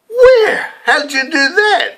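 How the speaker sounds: high, loud amazed squeal; astonished mum. Retold or imitated. Mum amazed at her son's winnings, astonished squeal. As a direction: gasp it in delighted disbelief. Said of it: "Mum amazed at winnings".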